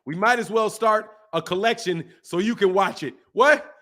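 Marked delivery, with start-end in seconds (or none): 3.3-3.8 sharp, higher, louder what; real surprised what. Real, not acted. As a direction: blurt it out in disbelief. Shocked reaction to the joke.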